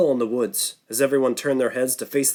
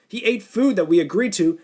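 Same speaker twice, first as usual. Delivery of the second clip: angry outburst; 7 semitones higher, 4 dB louder than usual. Acted. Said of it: "Sam exploded".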